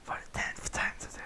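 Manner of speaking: soft unvoiced whisper reading; whispered narration. Reader whispering a racy story aloud, whispered. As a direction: breathe the words as a hushed story.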